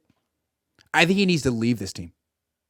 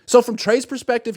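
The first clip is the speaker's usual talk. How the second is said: normal talking voice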